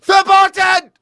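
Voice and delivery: piercing high, loud mock shout; German command. Host playing Germany answering France, shouted refusal. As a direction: fire back one forceful forbidding word. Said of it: "Germany and France".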